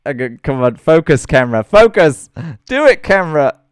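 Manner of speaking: loud, pleading at the camera; urgent loud coaxing. Real, not acted. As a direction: push the words out, urgent. Urging the camera to focus.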